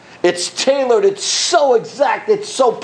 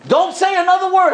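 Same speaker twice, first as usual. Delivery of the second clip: commanding warning; 11 semitones higher than usual. A narrator's reading. God silences the angels.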